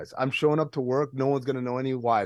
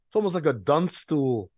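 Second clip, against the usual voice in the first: usual speaking voice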